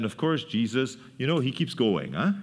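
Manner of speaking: ordinary delivery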